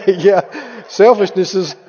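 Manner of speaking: real laughter breaking through the words; amused, chuckling agreement; laughing. Real, not acted. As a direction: talk through your laughter. Preacher laughs at audience's answer.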